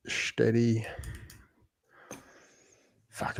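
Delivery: ordinary talk, nothing marked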